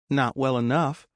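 high-pitched teasing reply; high, playful female-character voice; flirty bartender. Acted. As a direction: tease a regular in a high voice. Bartender flirts back after a wink.

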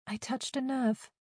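soft, high-pitched taunt; gloating. A narrator's reading. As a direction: needle her sweetly and quietly. Tormentor gloats at her reaction.

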